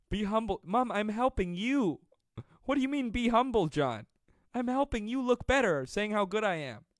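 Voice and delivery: high, loud protest to mom; indignant shouting. Acted. Mock quarrel with mom's comment.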